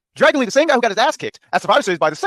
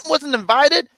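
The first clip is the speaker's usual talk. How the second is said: mock offended; 8 semitones higher, much slower than usual. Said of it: "and I'm like".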